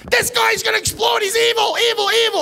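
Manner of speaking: high loud terrified shriek; panicked shriek. Retold or imitated. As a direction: scream it to the crew in terror. Woman alerting flight attendant.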